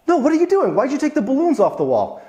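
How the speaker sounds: high, startled protest; surprised friend. Retold or imitated. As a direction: pitch way up in disbelief. Friend surprised, retold quarrel.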